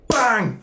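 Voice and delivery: shouted high crash noise; loud bang. Retold or imitated. Telling the crash scene, a loud high bang. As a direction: punch out the impact. Truck hits Paolo.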